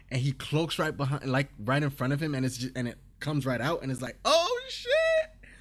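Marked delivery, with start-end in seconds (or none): 4.3-5.5 much higher, louder, slow-stretched hype yell; hyped shout. Real, not acted. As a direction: shout it like the best move ever. Hyped recap of the combo move.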